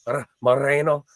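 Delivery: Moreno with a rolled Spanish r; Spanish pronunciation. Shown as a demonstration. Streamer trying to say the fighter's name right, careful Spanish r. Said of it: "I'm trying to say him right".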